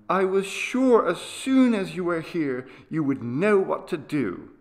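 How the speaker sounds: put-on high, eager child's voice; trusting sick boy. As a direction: pour out your relief to father. Grateful boy to his father.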